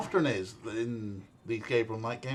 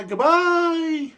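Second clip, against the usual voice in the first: much higher and louder sing-song goodbye; cheery, hammed-up farewell to chat; big sign-off; 10 dB louder than usual. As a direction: belt out the farewell like a cartoon host.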